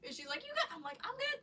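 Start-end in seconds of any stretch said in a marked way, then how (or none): 0.4-0.8 lightly high checking-in; high sister voice. Retold or imitated. Sister checking after the scare, high voice. 1.0-1.4 high giggly answer; laughing reply. Retold or imitated. Answering her sister, laughing.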